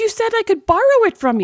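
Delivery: high-pitched playful outrage; indignant protest. Real, not acted. Friend insists she was only borrowing it, indignant. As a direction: argue back in a high, protesting voice.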